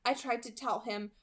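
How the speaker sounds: usual speaking voice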